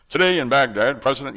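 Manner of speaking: lightly hammed-up newsreader; mock anchorman. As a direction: imitate a self-important news anchor. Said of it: "who talks like that in the real world?".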